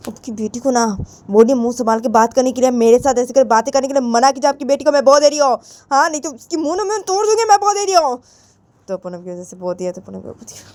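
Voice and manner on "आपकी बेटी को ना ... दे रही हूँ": high, indignant warning; threatening mother. Acted. Mother warning father about daughter's back-talk, threatening. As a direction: warn sharply, pitch climbing as you go.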